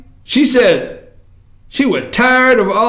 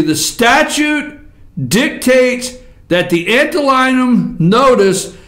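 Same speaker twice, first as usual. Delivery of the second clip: ordinary delivery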